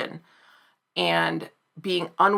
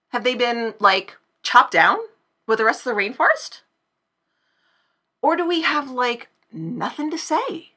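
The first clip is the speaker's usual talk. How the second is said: rising, louder and higher to a whiny peak on whatever; mock uptalk rant; 6 semitones higher, 5 dB louder than usual. Shown as a demonstration. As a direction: build the ironic valley rant to a shriek.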